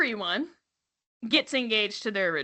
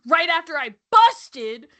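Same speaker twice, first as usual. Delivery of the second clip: louder, slower brag, hit hard; retold blurt; 4 semitones higher, 6 dB louder than usual. Host quotes what he's like on the show, louder retold blurt. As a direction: punch it out, imitating him. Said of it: "But then he's like".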